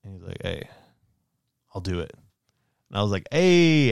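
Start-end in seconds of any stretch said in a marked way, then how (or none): 0.4-2.1 low, soft retold agreement; brother's hushed, reluctant yes; subdued agreement. Retold brother giving in late. 3.3-3.9 higher, drawn-out hey; retold happy hey; pleased cheer. Retold cheer at brother agreeing.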